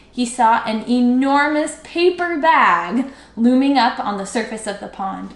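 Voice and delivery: usual speaking voice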